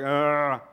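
face-palm groan, loud; a fed-up groan; mock exasperated groan; exasperated ugh. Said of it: "Then it's like".

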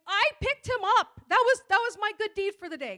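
high, loud mock outburst; arguing with God. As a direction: yell back in exasperated protest. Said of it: "I'm arguing in my head with God".

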